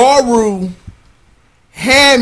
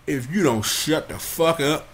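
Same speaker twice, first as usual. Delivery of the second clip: muttered threat; 6 semitones lower, 11 dB quieter than usual. Retold or imitated. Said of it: "And I'm just like".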